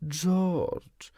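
high, drawn-out appeal; pleading. A narrator's reading. Wife begs her husband George, pleading. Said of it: "zaczęła go błagać pani Darling".

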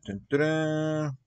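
usual speaking voice

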